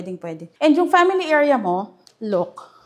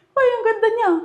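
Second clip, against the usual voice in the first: much higher, louder delighted exclamation; delighted gasp; 11 semitones higher, 4 dB louder, faster than usual. Real, not acted. Touring the bedroom, gushing delight at how pretty it is. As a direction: gush over it as you spot it. Admiring the room.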